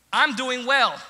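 loud, high, forced politeness; mad but sounding nice. Retold or imitated. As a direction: pointedly insist all is fine. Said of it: "really mad, but you sound nice".

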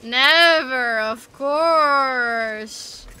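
loud, drawn-out sarcastic agreement; mock agreement. Real, not acted. Player teasing teammate about being over encumbered, loud sarcasm. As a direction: stretch it out loudly in disbelief.

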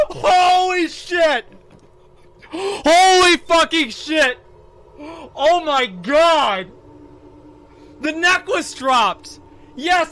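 high, loud, slow joyful screams; real overjoyed shouting at the drop; ecstatic yelling. Real, not acted. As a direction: scream with disbelieving joy. Loot dropped, ecstatic yelling.

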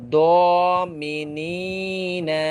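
each word drawn out slowly with long madd; elongated vowels. Shown as a demonstration. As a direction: read each word stretching the vowel one alif. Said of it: "এক আলিফ লম্বা করে পড়তেছি". (মদ টেনে ধীরে পড়া)

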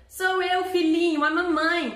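put-on the wolf at the door posing as the kid's mother; wolf faking mother. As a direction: coax the kid in a put-on mom voice.

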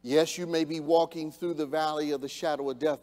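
ordinary delivery

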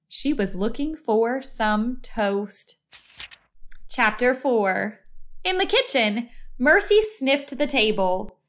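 plain reading voice

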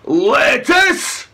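loud, high outraged cry. A narrator's reading. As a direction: cry out in disgust. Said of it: "cried Eric".

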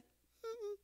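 very high, sing-song mm-mm; a teasing no from the teacher; mock teacher refusal.

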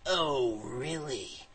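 very slow, soft sarcastic drawl; mocking disbelief. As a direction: stretch it out, unimpressed. Hero mocks dragons' boast.

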